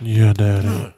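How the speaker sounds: loud, teasing interjection; playful cheeky interruption; mock sultry. Real, not acted. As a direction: tease him with a cheeky purr.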